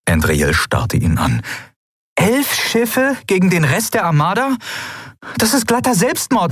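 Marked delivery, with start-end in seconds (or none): 2.2-6.5 very high-pitched, incredulous protest; aghast disbelief. A narrator's reading. Endriel objecting to eleven ships against the armada, aghast.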